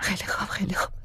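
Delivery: soft unvoiced whisper to steady herself. Trapped in the pod, whispering to calm herself. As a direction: breathe the words out, calming herself.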